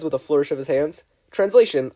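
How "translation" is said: plain reading voice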